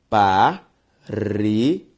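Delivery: "parede" split slowly into pa, re, de; syllable by syllable. Shown as a demonstration. Teacher separating a word for students, "parede" syllable by syllable. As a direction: sound out "parede" with a clear pause after each syllable. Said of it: "Se eu separo parede".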